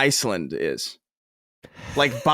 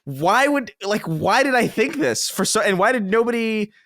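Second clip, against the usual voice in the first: retold disbelief; 6 semitones higher than usual. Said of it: "And I was like".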